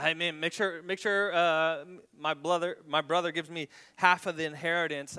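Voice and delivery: loud, insistent call from the crowd; mock shout at Jesus to settle the inheritance; shouted demand. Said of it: "just shouted out".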